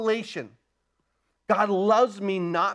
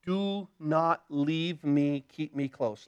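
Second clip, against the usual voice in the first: pleading softly; 4 semitones lower, 5 dB quieter, slower than usual. Retold or imitated. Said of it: "he says".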